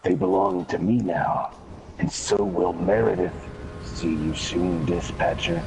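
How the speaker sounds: creepy voice. Retold or imitated. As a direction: speak like an eerie presence. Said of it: "you hear this voice come up and it says".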